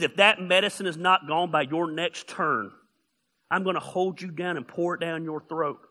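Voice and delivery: low, soft, deadly serious warning; a mother's calm menace; quiet threat. Retold or imitated. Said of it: "Christine says".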